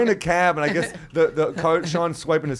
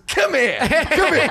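mock tough guy; 9 semitones higher, much slower than usual. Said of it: "Sean goes".

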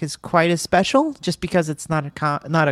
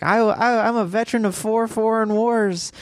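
higher-pitched imitation of a proud patriot neighbor; Hank Hill; 5 semitones higher than usual. Neighbor boasting of his war service, imitated cartoon voice. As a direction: put on the cartoon veteran's voice, pitched up. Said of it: "like, the Hank Hill character".

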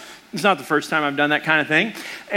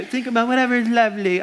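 higher, quick mocking feminine voice; 4 semitones higher, faster than usual. Preacher scoffing at thinking about lovely things, mock feminine. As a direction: make it sound daintily feminine, in mockery. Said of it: "That totally sounds feminine to me".

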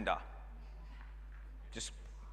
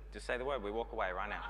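low casual offer; 7 semitones lower, 9 dB quieter, faster than usual. Retold or imitated. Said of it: "And it's like".

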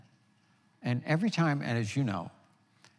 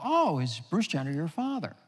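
star-struck question; 6 semitones higher than usual. Retold or imitated. Airport staff voiced, star-struck.

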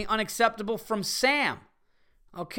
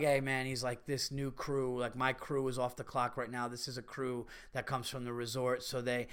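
softer, lower half-mumbled excuse; a bartender making excuses quietly; 6 semitones lower, 10 dB quieter than usual. Retold or imitated. Bartender quietly explaining the crew is off the clock, mumbling. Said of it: "he was just like".

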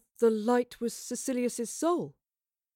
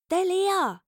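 high, loud warning; urgent alert; 10 semitones higher, 5 dB louder than usual. A narrator's reading. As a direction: call out that someone is coming. Sudden alert during play-wrestling.

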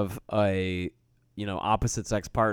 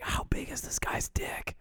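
whisper; 11 dB quieter, slower than usual. Real, not acted. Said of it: "it's fucked up to say this".

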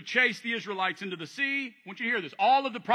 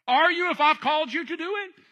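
ordinary delivery

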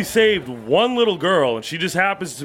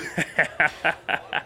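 put-on breathy, drunken laughing fit; teasing cackle; 3 semitones lower, 7 dB quieter than usual. Drunk friend gloating over a crush, cackling. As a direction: laugh at your own taunt.

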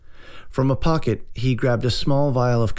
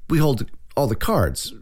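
higher-pitched bravado ending on a doubtful rising "right?"; unconvincingly confident; 6 semitones higher, slower than usual. A narrator's reading. Chad reassures himself about holding the cards, unconvincingly confident. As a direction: try to convince yourself and not quite manage. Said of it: "like he was trying to convince himself, but not quite managing".

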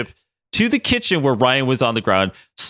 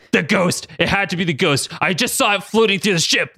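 yelling; 7 semitones higher than usual. A narrator's reading. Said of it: "Logan yelled".

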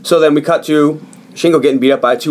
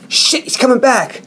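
high, drawn-out warning cry; acted alarm; 11 semitones higher than usual. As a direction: call out a mock-panicked warning. Acting out boys caught with sword.

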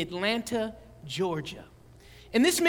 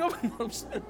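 laughing apology; 5 dB quieter, faster than usual. Real, not acted.

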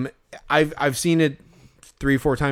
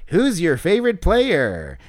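slow, stilted interviewer, pitched up; canned hot dog host; 4 semitones higher, slower than usual. Acted. Hot dog character interviews a little kid, stilted and drawn out. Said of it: "that's a good impression that you're doing".

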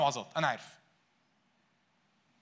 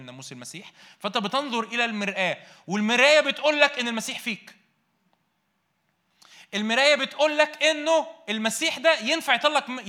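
raised, high, ringing sermon voice; preaching at full force; 5 semitones higher, 6 dB louder than usual. Acted. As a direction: preach it out loud to the whole church. Preaching loudly to congregation.

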